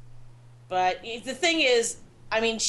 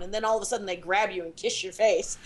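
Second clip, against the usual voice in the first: ordinary delivery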